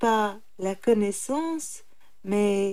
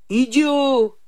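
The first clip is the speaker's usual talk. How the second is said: loud, higher insult; angry tiger; 4 semitones higher, 8 dB louder than usual. A narrator's reading. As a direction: hurl it out in irritation. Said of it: "répondit le tigre". (tigre excédé, insulte forte)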